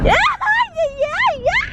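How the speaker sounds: very high squealing yeah; delighted squeal. Real, not acted. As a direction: squeal it out with glee. Squeal while tickled.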